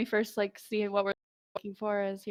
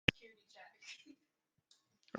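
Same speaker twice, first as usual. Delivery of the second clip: whispered; 15 dB quieter, much slower than usual. Acted. Unvoiced trailing.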